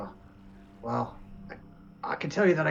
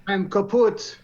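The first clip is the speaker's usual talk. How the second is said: put-on high, loud German accent; Rolf; 8 semitones higher, 5 dB louder, slower than usual. Player as wounded Rolf gives up, high German accent. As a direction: declare it in a comic German accent. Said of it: "had, like, a German accent".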